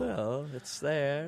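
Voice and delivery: slow, teasing drawl. Acted. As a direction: drag it out teasingly.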